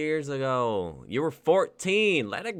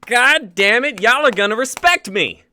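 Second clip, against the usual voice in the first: loud, higher furious yell; angry shout; 7 semitones higher, 11 dB louder than usual. Acted. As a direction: explode at everyone. Ringo's outburst at enemies.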